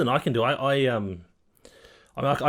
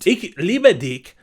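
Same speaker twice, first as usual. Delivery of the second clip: slow, loud, higher German phrase; mock German; 5 semitones higher, 7 dB louder, much slower than usual. Co-host showing off German phrases, hammed-up German. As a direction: lay the German on slowly and loudly. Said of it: "I don't know if that's German anymore".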